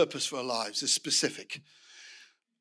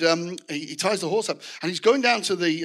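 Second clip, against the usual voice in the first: normal talking voice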